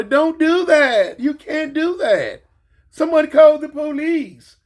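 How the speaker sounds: high, slow, prim mock scold; hammed-up prissy bystander voice; mock nosy onlooker.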